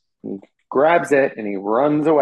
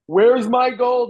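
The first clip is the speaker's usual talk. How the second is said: high, loud, slow giant cry; a giant roaring for his gold, drawn out; 12 semitones higher, 5 dB louder, slower than usual. Retold or imitated. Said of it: "he's like".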